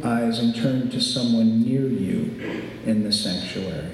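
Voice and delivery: usual speaking voice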